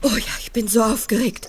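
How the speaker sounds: breathless, nervous whisper. Acted. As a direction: whisper it, too anxious for voice. Nervous mother awaiting kidnapper call. (aufgeregt flüsternd)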